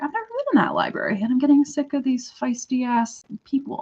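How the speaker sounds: high, hurried mock exasperation; acted fed-up outburst. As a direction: replay being sick of them, pitch jumping up. Acted fed-up remark to herself.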